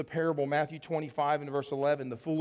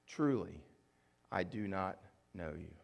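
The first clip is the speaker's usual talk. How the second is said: solemn low verdict; 8 semitones lower, 6 dB quieter than usual. Acted. Quoting the groom's verdict.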